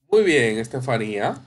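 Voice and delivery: usual speaking voice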